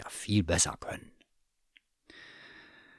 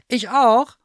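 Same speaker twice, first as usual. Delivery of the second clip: much higher and louder eager squeak; delighted surprise; 12 dB louder than usual. Acted. Bear astonished he has a secret name, high and loud. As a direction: burst out in hopeful wonder. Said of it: "fragte Thomas Müller".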